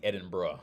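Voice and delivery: "Edinburgh" ending in "bruh"; local pronunciation. Shown as a demonstration. Confirming the right way, "Edinburgh" as "Edin-bruh". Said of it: "you pronounce it Edinburgh".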